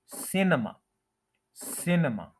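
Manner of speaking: सिनेमा as "SIN-uh-ma", schwa in the middle; correct pronunciation. Shown as a demonstration. Teacher modelling for learners, careful pronunciation. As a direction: start सिनेमा with "sin", then a weak "uh". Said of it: "उसके बाद श्वा sound है".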